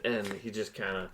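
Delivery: normal talking voice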